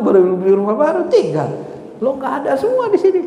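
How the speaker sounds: high, animated comic lament; mock dismay. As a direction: play someone aghast at leaving everything behind. Acts out losing possessions at death. (ratapan kaget pura-pura bernada tinggi)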